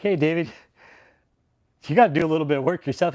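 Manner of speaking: a lightly voiced scolding God, fast; voicing God. God nags David to do some work himself. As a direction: play God, nagging a little. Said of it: "God's like".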